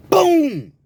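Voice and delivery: loud, high imitated boom; loud mouth sound for a knockout hit; punch sound effect. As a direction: burst out a punch noise. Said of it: "John hits him again".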